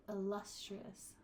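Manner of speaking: "illustrious" slow, LUS weighted; stress on LUS. Shown as a demonstration. Teacher moving the emphasis, second syllable stressed. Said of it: "feel the emphasis move".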